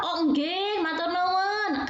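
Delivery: imitating a Javanese speaker politely thanking, slightly drawn out; Javanese medok accent. Lecturer imitating Javanese speech for students, light medok accent. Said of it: "Agak medok-medok gitu ngomongnya".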